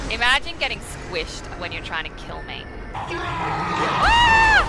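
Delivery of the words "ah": sudden high scream, louder. Real, not acted. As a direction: yelp in fright. Jump scare in game.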